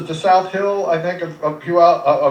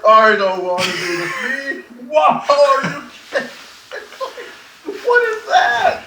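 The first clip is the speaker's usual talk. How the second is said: high, drawn-out mock protest; imitated protesting wife; 8 semitones higher than usual. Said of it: "she's in there".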